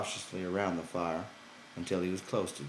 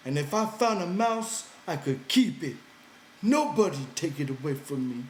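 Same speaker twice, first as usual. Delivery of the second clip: Lenny pouting, higher and louder; high, sulky simple man; 8 semitones higher, 6 dB louder than usual. Acted. Lenny threatening to go live in a cave, high and sulky. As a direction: push it up high with a hurt edge.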